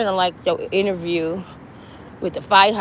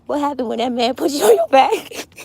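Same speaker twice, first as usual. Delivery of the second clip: higher, nosy teasing put-on voice; mock Charlamagne; 6 semitones higher than usual. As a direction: needle her like a messy host. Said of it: "Charlamagne gonna be like".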